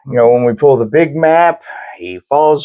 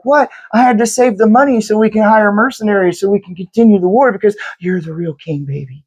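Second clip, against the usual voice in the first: higher-pitched mock innocence, softening into sweet talk; gaslighting lover; 7 semitones higher than usual. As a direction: play a manipulative queen placating her man. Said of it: "gaslighting bullshit where she's like".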